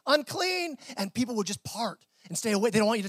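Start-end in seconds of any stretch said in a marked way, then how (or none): 0.1-0.8 raised, higher mock call of a leper; leper's warning call. A preacher calling out a leper's warning, raised and high. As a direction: call it out so people step aside. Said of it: "you would have to yell out".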